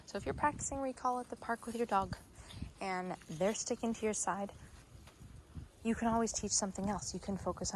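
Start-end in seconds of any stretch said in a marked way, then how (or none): none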